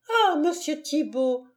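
put-on high alarmed cry of a girl; frightened cry. A narrator's reading. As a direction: cry out his name in fright. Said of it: "s'écria l'enfant".

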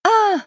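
high startled yell as she slips; loud scream. Acted. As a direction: scream out in fright. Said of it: "С громким воплем". (громкий вопль)

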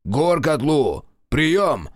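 крик в рацию; громко вызывай по рации; прокричал в рацию. Acted. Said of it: "прокричал он в микрофон". (крик в рацию)

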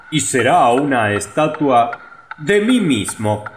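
high, loud boastful mayor; vain mayor. A narrator's reading. Mayor declaring a statue of himself. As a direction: proclaim it with self-importance.

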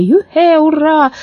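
high-pitched whooping; cheering shout. A narrator's reading. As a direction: whoop with the crowd. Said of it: "закричали в толпе".

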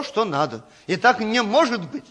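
normal talking voice

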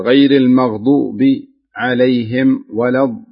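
slow, with small wrong stops between the words; choppy wrong pauses. Shown as a demonstration. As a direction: break "غير المغضوب عليهم" with needless pauses. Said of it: "تقول". (تلاوة متقطعة بسكتات خاطئة)

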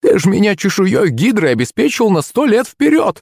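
high, excited granny voice; flustered old woman. A narrator's reading. Old woman thanking the hunter, flustered and delighted. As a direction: fuss happily in a high old-lady voice. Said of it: "засуетилась она".

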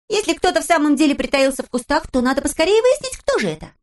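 put-on high, lively girl's voice; Pippi voice. A narrator's reading. Narrator voicing Pippi, high and perky. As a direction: play Pippi as a cheeky girl.

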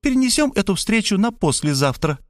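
put-on high, reproachful old mother; mother's nagging voice. A narrator's reading. Mother scolding son at the door, high and reproachful. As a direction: scold him like a fussy mother.

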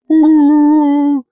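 loud, very high sustained wail; anguished howl. A narrator's reading. Exasperated narrator summoned at a bad moment, loud high howl. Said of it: "взвыл я".

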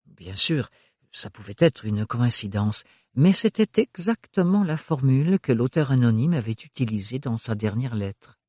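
ordinary talk, nothing marked

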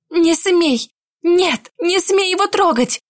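furious shout. Acted. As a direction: scream at him to stop. Heroine defends beaten lover.

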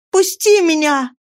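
high, urgent entreaty; determined high-pitched pleading; resolute plea. A narrator's reading. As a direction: beg firmly in a high female voice. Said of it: "тоном решительной мольбы сказала она".

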